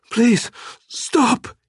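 high, pained gasped plea; woman in pain gasping at her torturer to stop. Acted. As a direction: beg through the pain. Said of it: "she gasped".

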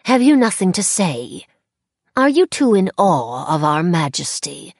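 mock the child from a throne; mocking faerie queen. A narrator's reading. Crowned woman taunting the child: high, loud scorn. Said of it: "said the woman in the crown".

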